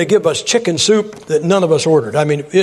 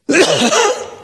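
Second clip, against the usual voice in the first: high, staged choking cough; mock choking cough; 10 semitones higher than usual. Preacher acts out choking at a restaurant, staged high cough. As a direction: cough as if food is stuck in your throat. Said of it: "It's stuck in my throat".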